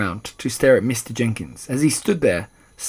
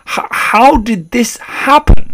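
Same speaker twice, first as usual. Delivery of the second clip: high, loud, drawn-out question; aghast stammer; 11 semitones higher, 11 dB louder, slower than usual. A narrator's reading. Father baffled by his son's change, stammering aghast. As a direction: catch on the first sound, then wail it. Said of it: "stammered Mister Jenkins again".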